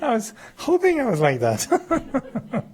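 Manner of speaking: high, slow, through real laughter; laughing joke. Real, not acted. Joking about seeing his own back, laughing. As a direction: barely get it out through the laughs.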